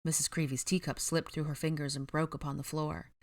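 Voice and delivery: plain reading voice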